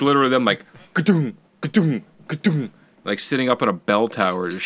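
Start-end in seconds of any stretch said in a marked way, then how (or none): 0.4-2.7 high mock crossbow thunks; crossbow sound effect. Friend imitating the movie's crossbows, high-pitched mock thunks. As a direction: mimic a giant crossbow firing rapidly. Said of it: "shooting these giant fucking, like, crossbow".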